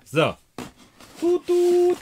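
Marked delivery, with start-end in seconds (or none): 1.2-2.0 very high, loud "toot toot"; high-pitched held tooting; imitated horn toot. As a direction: hoot like a little horn.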